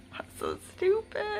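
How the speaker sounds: high, quiet laughter through the words; helpless high-pitched giggle while talking; laughing. Real, not acted. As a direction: barely get it out for laughing. Laughing at her lost ring.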